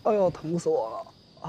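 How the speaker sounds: slow, high, hammed-up wail of pain; milk the pain for sympathy; exaggerated pain. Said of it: "别装了".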